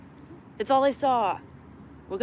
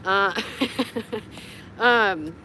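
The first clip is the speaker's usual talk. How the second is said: ordinary talk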